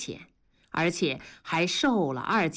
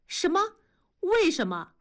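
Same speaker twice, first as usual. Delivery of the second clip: 惊讶追问；高声惊问；猛地拔高嗓门质问；妻子翻身瞪着丈夫惊讶地追问; 10 semitones higher than usual. Acted. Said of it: "文丽翻身瞪着同志问".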